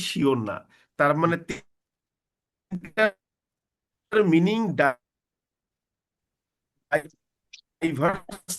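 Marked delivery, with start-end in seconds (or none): 2.7-8.5 choppy breaking-up internet audio; words cutting out over a bad connection; glitchy robotic call. Real, not acted. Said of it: "কথা কেটে কেটে".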